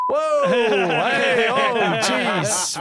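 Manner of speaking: loud startled yelps; startled shout. Real, not acted. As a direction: jump at a sudden blast. Sudden loud music surprise.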